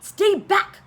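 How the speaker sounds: sharp warning shout. Acted. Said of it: "shouted Bruce".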